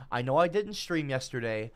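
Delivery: ordinary delivery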